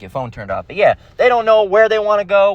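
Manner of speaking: ordinary talk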